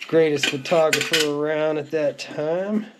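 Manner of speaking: usual speaking voice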